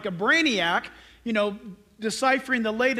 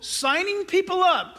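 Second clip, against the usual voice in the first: "signing people up" higher and drawn out; quote-marked phrase; 7 semitones higher than usual. Shown as a demonstration. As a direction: set off "signing people up" slowly, in high pitch.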